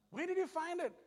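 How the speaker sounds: high-pitched mock disbelief; puzzled question. Husband asks his wife how she found it, high puzzled voice. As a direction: pitch it up, quizzing her in amazement.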